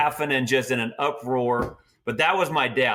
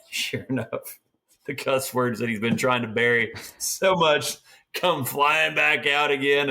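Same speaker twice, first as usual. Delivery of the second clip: ordinary talk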